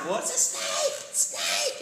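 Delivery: imitating a frantic, very high shout; retelling a frantic cry about the snake; mock panicked yell. Shouting back in snake scare.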